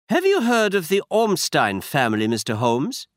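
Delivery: put-on a masked aristocrat in a German accent. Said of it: "He had a strong German accent".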